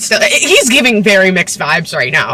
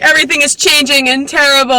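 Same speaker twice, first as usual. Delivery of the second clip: lightly hammed-up, higher-pitched preteen angst; a touch of mock teen melodrama; preteen girl angst; 5 semitones higher than usual. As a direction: imitate a dramatic preteen, slightly higher. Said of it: "preteen girl angst".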